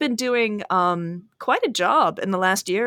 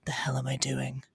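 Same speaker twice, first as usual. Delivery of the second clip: anxious whisper; 3 semitones lower than usual. Retold or imitated. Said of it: "a definite feeling of like".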